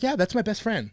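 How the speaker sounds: a soft, quiet take on Albie's voice, barely changed; lightly imitating Albie; Albie impression. Mocking Albie to his podcast co-host, a quiet, lightly voiced Albie. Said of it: "that's exactly Albie's voice".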